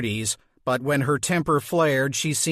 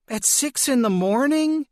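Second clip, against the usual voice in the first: incredulous mother; 10 semitones higher than usual. Acted. Mother woken, irritated.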